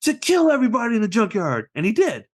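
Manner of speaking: high, theatrical boy narrator imitation; Squints. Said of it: "his narration".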